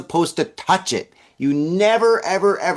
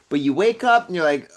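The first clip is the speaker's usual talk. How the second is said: ordinary talk, nothing marked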